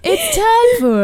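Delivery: high, slow booming announcer voice; game show host. Shown as a demonstration. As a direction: stretch it out like a TV host. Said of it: "why do I put on my game show voice?".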